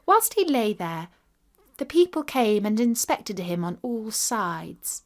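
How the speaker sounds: ordinary delivery, nothing marked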